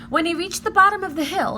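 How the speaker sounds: usual speaking voice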